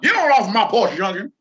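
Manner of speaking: put-on loud, high grumpy old man; shouting at trespasser. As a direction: chase the kid off your porch. Old man yelling at kids.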